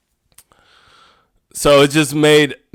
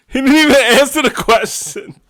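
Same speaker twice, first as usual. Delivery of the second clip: much higher, incredulous; disbelieving cry; 11 semitones higher than usual. Real, not acted. Reacting to the dodged tariff question, a high incredulous cry. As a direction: squeal it in disbelief.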